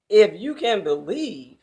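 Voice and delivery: loud, incredulous sarcastic question. Acted. Jesus sarcastically questioning the father's doubt, loud. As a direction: throw the words back at him mockingly. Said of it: "in a sarcastic question".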